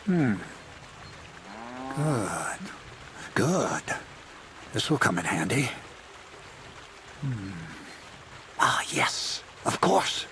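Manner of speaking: muttering to oneself, softly; absorbed murmur with long pauses; lost in thought. Acted. Schemer reading a document to himself, lost in thought. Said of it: "I got lost in thought".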